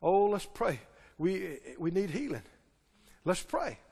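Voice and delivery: soft, low whiny call to pray; mock pleading prayer. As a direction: parody anxious believers begging. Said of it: "Still pleading and begging God".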